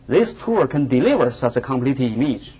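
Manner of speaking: ordinary delivery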